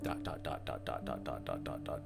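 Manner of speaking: ordinary talk, nothing marked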